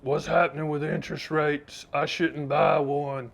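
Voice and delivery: worried client voice, the last words very slow; put-on client voice, dragging out I shouldn't buy one. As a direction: play a fretful annuity client. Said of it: "That's my client voice".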